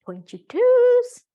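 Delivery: high loud drawn-out call. Real, not acted. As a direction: sing out the cue. Instructor calling out.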